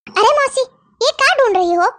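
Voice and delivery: ordinary talk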